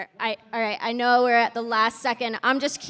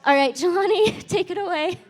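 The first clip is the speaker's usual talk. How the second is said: higher and louder, laughing into the name; upbeat handoff; 6 semitones higher, 5 dB louder than usual. Real, not acted. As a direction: brightly hand the stage over. Moderator hands off to next host.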